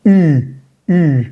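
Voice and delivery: U as "i" through rounded lips; isolated French "ü" sound for U. Shown as a demonstration. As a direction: hold U with puckered lips, high pitch. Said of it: "va a sonar algo así como".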